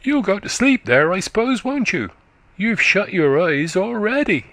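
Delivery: put-on high, quick childish voice of a country boy; little boy chatting up to a tired woman, high and eager; chattering little boy. A narrator's reading. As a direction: prattle like a small eager lad. Boy speaks to her.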